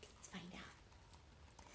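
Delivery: slow, hushed secret; whisper. Real, not acted. Teller to listeners.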